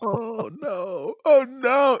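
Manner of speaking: high, wailing oh no; mock anguished cry. Real, not acted. Cohost reacting to news with a wailing cry.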